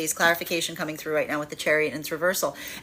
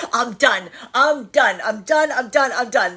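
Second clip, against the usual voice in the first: higher and louder, half laughing, mock fed up; exasperated repeat; 5 semitones higher, 5 dB louder, slower than usual. Energy in separation voicing its frustration, mock exasperated and louder. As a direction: throw it up in the air, I'm done, I'm done.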